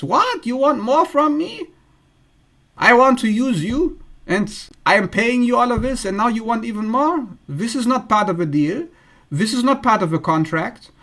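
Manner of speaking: high, indignant voice that settles down; mock indignant avoidant. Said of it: "this is how an avoidant attachment style person reacts".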